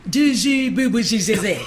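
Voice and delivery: badly faked German gibberish; mock German. Officer answering a German lady's curse in kind, mock German. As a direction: babble nonsense as pretend German, close to laughing. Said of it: "I can't do the German accent".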